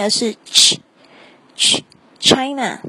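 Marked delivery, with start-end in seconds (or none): 1.6-2.8 ch，ch isolated, then China with an English ch, not chi; ch sound demo. Shown as a demonstration. Teacher drilling the ch sound for learners, carefully. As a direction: sound out ch before the word. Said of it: "不是吃的发音".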